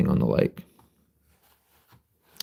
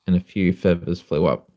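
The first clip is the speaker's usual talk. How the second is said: ordinary delivery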